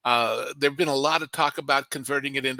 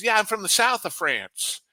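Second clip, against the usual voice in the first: lightly imitated Southern accent, a bit higher; 7 semitones higher than usual. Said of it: "thick Southern accent".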